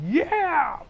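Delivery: high excited yelp; a quick fan cheer. Retold or imitated. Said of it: "I was cheering".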